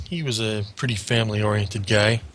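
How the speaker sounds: ordinary delivery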